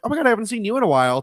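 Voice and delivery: high, rapid gushing imitation; retold excited greeting. Handler greeting him at the signing, imitated excited rush. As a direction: imitate a gushing, fast hello. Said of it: "the handler or whatever was like".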